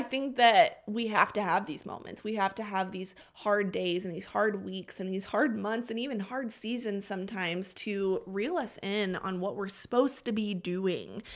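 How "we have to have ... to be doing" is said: ordinary delivery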